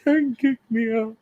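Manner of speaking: high, drawn-out fake wail; mock crying. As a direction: whine it out slowly like a hammed-up cry. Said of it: "sit outside the room and cry".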